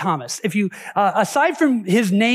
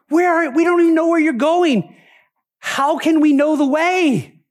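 higher-pitched, plaintive protest; childlike distress; 6 semitones higher than usual. Acted. Thomas protests to Jesus about his leaving, childlike and upset. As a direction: plead like a bewildered child being left behind. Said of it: "it's almost childlike".

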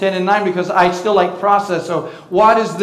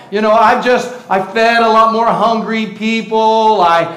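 sarcasm. Acted. Pastor mocking showy churchgoers, sarcastic and building to a shout. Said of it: "That's sarcasm".

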